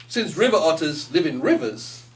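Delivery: ordinary delivery, nothing marked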